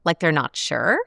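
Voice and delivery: "sure" swung sharply up as if asking; doubtful upspeak on "sure"; unsure question inflection. Shown as a demonstration. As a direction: sound uncertain by rising on "sure". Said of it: "then suddenly asks".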